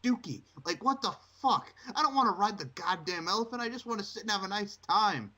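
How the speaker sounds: high-pitched fed-up rant; exasperated rant. Real, not acted. Ranting about the circus wedding.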